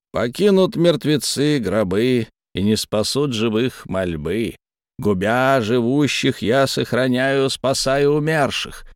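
slow, solemn chanted verse; slow ominous incantation. Acted. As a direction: intone it like a dark prophecy. Creature chants rhyming verse.

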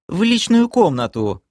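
high, mildly hurt reply; lightly acted girlish huff; offended whine. A narrator's reading. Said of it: "обиженно протянула девушка".

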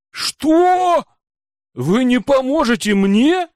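loud, very high squeal of a question; wide-eyed outraged surprise; shocked disbelief. A narrator's reading. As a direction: gasp it out, high and incredulous. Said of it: "округлила она глаза".

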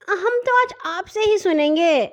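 put-on high childlike insistence; a little girl digging in her heels; insisting. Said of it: "وہ ضد کرنے لگی". (ننھی کی ضد بھری اونچی آواز)

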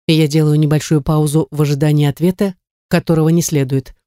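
ordinary delivery, nothing marked

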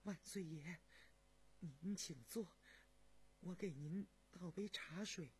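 hushed, flustered deference; slightly trembling, uneasy. A narrator's reading. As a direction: greet the emperor nervously, with a faint quaver. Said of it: "声音也有点发颤".

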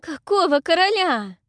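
high, loud howl; girl holding her forehead howling. A narrator's reading. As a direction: howl it in pain. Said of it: "провыла я".

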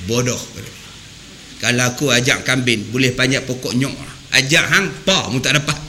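a low mock insult from the teacher; teacher's scolding. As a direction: scold the pupil like the harsh guru. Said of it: "bila dia maki".

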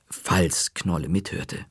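ordinary delivery, nothing marked

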